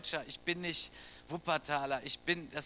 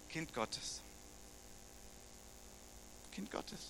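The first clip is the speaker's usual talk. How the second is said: quiet; 15 dB quieter, slower than usual. Real, not acted. Hushed repeat of key phrase.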